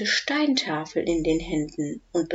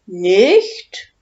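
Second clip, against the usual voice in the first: puzzled; 8 dB louder than usual. Acted. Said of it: "sagt Udo verblüfft".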